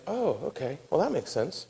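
soft, lightly acted acceptance; mildly accepting. Manoah answering the angel's instructions, quiet acceptance. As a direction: quietly go along with it.

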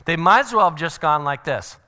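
normal talking voice

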